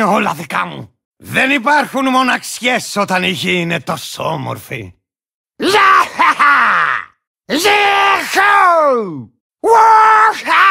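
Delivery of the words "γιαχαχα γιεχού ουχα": wild battle whoops. Acted. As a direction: whoop like a rider charging in. (άγριες πολεμικές ιαχές)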